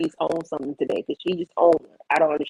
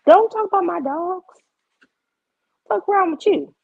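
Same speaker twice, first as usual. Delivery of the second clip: high-pitched telling-off; indignant; 10 semitones higher than usual. Real, not acted. Dog owner warning critics off her dogs, high indignant. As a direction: pitch it way up in outrage.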